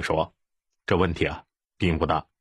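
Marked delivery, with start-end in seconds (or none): none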